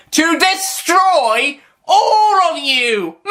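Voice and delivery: very high, slow gloat breaking into a laugh; villain gloat. Acted. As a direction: stretch it out, cackling. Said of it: "that's the Daleks".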